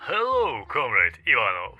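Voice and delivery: put-on hushed mystery voice with Georgian accent; mysterious Georgian voice. A narrator's reading. Mysterious superior greeting by phone, soft Georgian accent. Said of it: "first mysterious voice with a Georgian accent".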